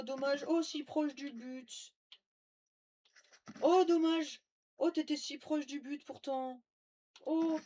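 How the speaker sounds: high, loud fake pity; mock sympathy. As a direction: gloat with fake sorrow. Mocking a fleeing target.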